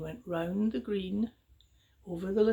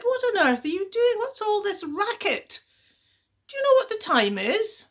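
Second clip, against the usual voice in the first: high voiced irritated villagers; sleepy annoyance, pitched up; grumpy parents; 12 semitones higher, 6 dB louder than usual. A narrator's reading. Woken parents scolding the girl, irritated and high.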